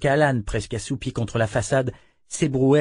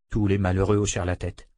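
plain reading voice

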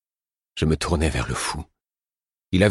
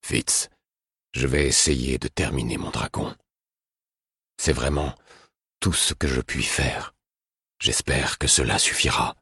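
gentle, lower and softer; tender, hushed king's voice; 4 semitones lower, 3 dB quieter than usual. A narrator's reading. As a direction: speak softly to Fitz, resigned. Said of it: "puis dit d'une voix douce".

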